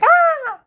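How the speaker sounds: shrill high-pitched mock scream; mock fish scream. Reviewer mimics the fish's silly scream, high and loud. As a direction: let out a sudden, squealing fish shriek. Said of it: "fish randomly scream like".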